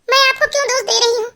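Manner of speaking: high-pitched, wounded self-blame; hurt wife. Acted. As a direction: turn the reproach on yourself, pitched high. Narrator plays hurt wife blaming herself. (दुखी पत्नी, ऊँचे सुर में)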